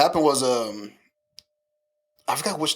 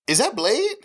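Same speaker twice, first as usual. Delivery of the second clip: mock surprise; 12 semitones higher, slower than usual. Retold clueless question from sister.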